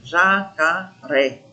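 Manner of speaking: "jacaré" in three slow, separate syllables; sílaba mais forte. Shown as a demonstration. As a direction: sound out "jacaré" as ja, ca, ré for learners. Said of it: "Olha mais forte". ("jacaré" pausado, sílaba a sílaba, para achar a tônica)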